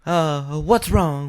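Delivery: high, cooing concern; hammed-up worried wife. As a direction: fuss over your husband as a Southern diva. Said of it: "my [name] impersonation".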